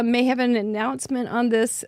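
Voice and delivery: ordinary talk, nothing marked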